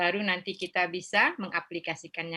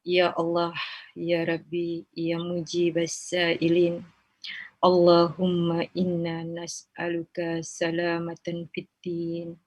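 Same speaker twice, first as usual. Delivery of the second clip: measured chanted supplication with long pleading pauses; leading a prayer; 8 dB louder than usual. Acted.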